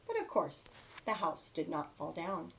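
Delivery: plain reading voice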